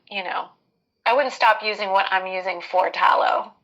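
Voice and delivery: ordinary talk, nothing marked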